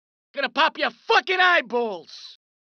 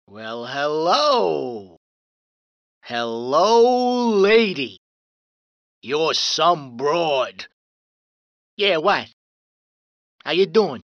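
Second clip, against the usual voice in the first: sleazy flirt; 10 semitones lower, slower than usual. Acted. Thug flirting with a woman.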